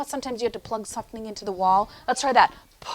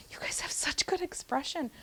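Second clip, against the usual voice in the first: whispered praise; 3 semitones higher, 12 dB quieter than usual. Real, not acted. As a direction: softly whisper the compliment. Praising children softly.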